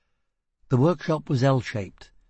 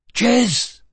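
loud, high bellow of a name; 12 semitones higher, 6 dB louder than usual. A narrator's reading. Fugitive searching the cell doors for his partner, bellowed. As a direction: bellow out for her. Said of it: "he bellowed".

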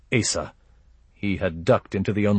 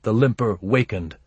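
plain reading voice

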